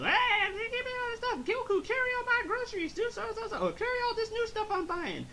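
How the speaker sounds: very high mock nagging; hammed-up bossy wife in falsetto; Chi-Chi. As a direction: order him about in a squeaky high voice. Voices Chi-Chi bossing Goku around.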